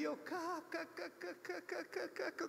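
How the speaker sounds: high, soft cackling laugh spelled out; imitated laugh. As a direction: act out a fit of giggles, high and breathy-light. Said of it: "Aí eu comecei a rir". (risada imitada, aguda e suave)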